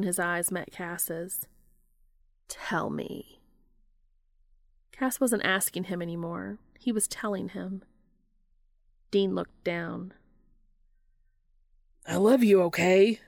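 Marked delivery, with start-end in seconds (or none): 2.5-3.4 lower, whispered demand; low command. A narrator's reading. 12.0-13.2 much louder, bursting out; blurted confession. A narrator's reading.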